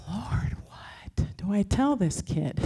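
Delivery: slow, whispered aside to God; whispered plea. Retold or imitated. Teacher asks the Lord how to answer a kid, whispering. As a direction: stretch it out in an urgent stage whisper.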